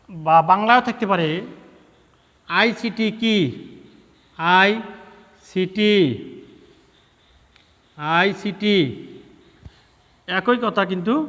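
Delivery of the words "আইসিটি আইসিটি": slow. Shown as a demonstration. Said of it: "teacher dictating answer slowly".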